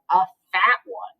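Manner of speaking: a hint of a low billy goat. Mister Goat adding to the news, low goat voice.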